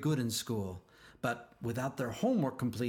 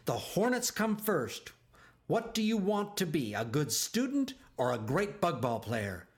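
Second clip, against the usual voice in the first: angry coach; 6 semitones higher than usual. A narrator's reading. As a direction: lay into him, outraged. Coach scolding Bubba.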